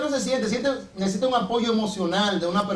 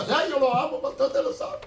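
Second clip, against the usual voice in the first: swooning sister; 5 semitones higher than usual. Retold or imitated.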